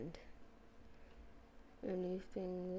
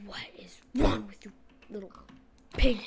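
loud whispered scold; mock scolding whisper; 12 dB louder than usual. Player scolding the little pig, in a loud whisper.

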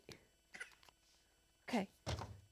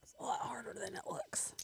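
whispering; 2 semitones higher, 13 dB quieter than usual. Real, not acted. Struggling with hole punch.

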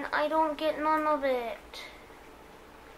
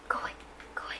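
ordinary talk, nothing marked

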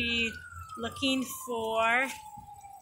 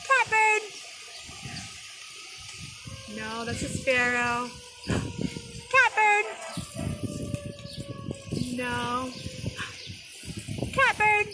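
excited call; 10 semitones higher than usual. Shown as a demonstration. Teacher plays the spotting game, shouting the name. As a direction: shout it out when you spot it. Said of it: "you're gonna go".

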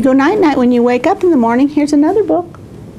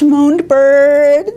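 plain reading voice

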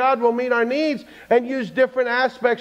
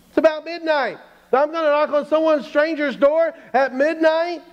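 high, agitated pleading with God; incredulous protest; 5 semitones higher than usual. Acted. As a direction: argue back, appalled at the order. Acted protest against God's order.

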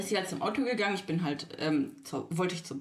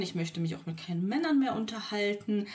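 usual speaking voice

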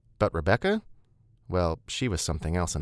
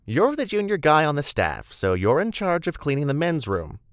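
high, louder voice of a bossy colleague; brisk, chatty Beth; 8 semitones higher, 4 dB louder than usual. Acted. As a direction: hand out duties cheerily, pitched up.

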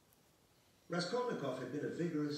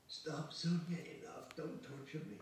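pained, higher-pitched plea; miserable begging; 7 semitones higher than usual. Acted. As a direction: beg miserably to be left alone. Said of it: "he begged her miserably".

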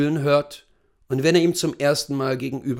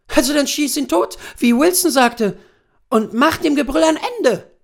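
much higher, louder wife's voice; goading wife; 13 semitones higher, 6 dB louder than usual. Acted. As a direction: push your nervous husband to act. Wife goading her husband. (hohe, laute, drängende Ehefrau)

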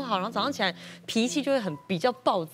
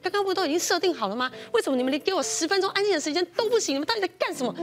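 higher-pitched scolding tirade; retold angry rant; 7 semitones higher, faster than usual. Mom scolding the kids for breaking the computer, higher and rising. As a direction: rant at the kids while walking over. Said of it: "然后我就边走边骂".

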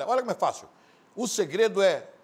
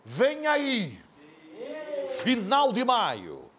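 slow, loud, drawn-out TV trailer voice; promo announcer; 10 semitones higher, 4 dB louder, much slower than usual. Acted. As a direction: stretch it like a dramatic promo.

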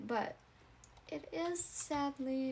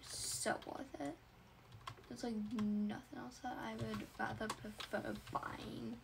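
whispering; 3 semitones lower, 10 dB quieter than usual. Acted. Whispered aside to camera.

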